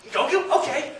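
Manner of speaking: high, pleased little "Goku? Okay."; surprised Goku question. Retold or imitated. Voice actor perking up at the offer, surprised. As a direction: perk up at the role. Masuko's reaction, quoted high.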